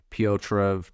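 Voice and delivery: ordinary delivery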